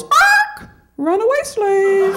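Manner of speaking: high squawk, then slow, dragged words; parrot voice. Retold or imitated. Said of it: "the parrot goes".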